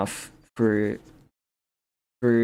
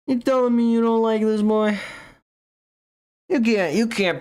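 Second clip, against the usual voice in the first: mock challenge; 10 semitones higher than usual. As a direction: dare them in a high squeaky voice. Showing off drawing, voice jumps.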